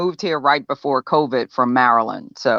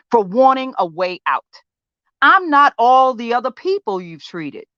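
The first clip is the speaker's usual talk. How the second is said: understated, wounded defiance in a monologue; frustrated patient confronting therapist; 6 semitones higher than usual. Acted. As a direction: make your case to someone who won't listen, pausing between points. Audition monologue from a play.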